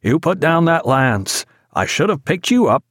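cried out. A narrator's reading. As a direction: cry out to the man with the lance. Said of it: "cried the master".

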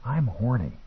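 soft, sly and secretive; conspiratorial whisper. A narrator's reading. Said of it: "dropping to a conspiratorial whisper".

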